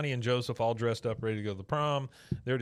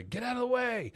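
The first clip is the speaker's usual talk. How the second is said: much higher, squeaky bully voice; mock yell; 13 semitones higher than usual. High school kid ordering Bobby aside, squeaky mock yell. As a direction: squeal it like a bossy teen.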